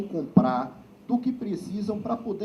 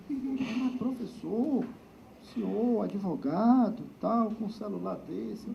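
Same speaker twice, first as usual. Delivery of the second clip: high, mocking voice of a colleague; teasing colleague; 5 semitones higher, 4 dB quieter, slower than usual. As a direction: tease him about the old phone. Colleague teasing about old phone.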